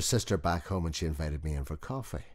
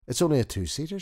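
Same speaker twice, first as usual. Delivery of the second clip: lightly voiced doubtful wife, higher and louder; higher wife's voice; 12 semitones higher, 7 dB louder, faster than usual. A narrator's reading. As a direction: lift the voice into the wife, a little sceptical.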